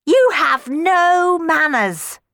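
high, loud, drawn-out scolding; slow, indignant telling-off; chiding. A narrator's reading. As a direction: scold haughtily, stretching the words. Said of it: "Clorinda chided Cenerentola".

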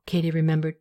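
usual speaking voice

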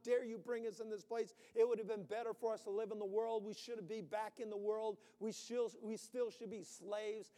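loud, high, furious accusing rant; shouted grievance of a thirsty people; angry complaining crowd. Acted. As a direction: rail at the leader like an angry mob. Preacher voicing angry Israelites.

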